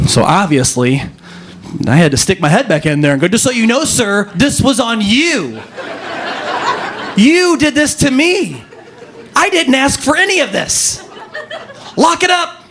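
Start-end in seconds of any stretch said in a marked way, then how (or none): 3.3-12.6 hammed-up, high-pitched reprimand to a stranger; mock indignant scolding. Acted scolding of stranger in bathroom.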